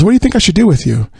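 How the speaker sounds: menacing father. Retold or imitated. Father menacing the security guard.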